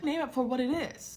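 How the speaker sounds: high-pitched, insistent quote; imitated emphatic friend. Friend urging her to name it, emphatic high voice. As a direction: urge it in a high, dramatic voice. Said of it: "But she said".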